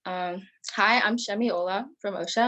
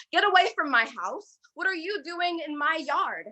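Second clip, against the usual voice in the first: a mild acted yell, pitched higher; yelling woman; 8 semitones higher than usual. Therapist shouts at stranger in her yard, mild acted yell. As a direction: throw it at a stranger on the porch. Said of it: "yells at the top of her lungs".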